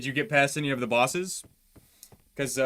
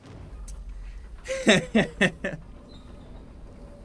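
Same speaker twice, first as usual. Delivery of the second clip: awkward little giggle; nervous laugh; 8 semitones higher than usual. Real, not acted. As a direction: laugh nervously under your breath. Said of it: "stop doing that little awkward laugh".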